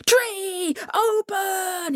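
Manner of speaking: high, drawn-out command; crying out. A narrator's reading. Coyote ordering the tree to open, high crying out. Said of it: "he cried".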